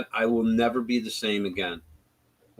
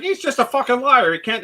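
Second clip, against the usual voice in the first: high, louder put-on voice of a ranting heckler; angry heckler; 12 semitones higher, 4 dB louder than usual. Retelling campus heckler ranting at Charlie, higher mock voice.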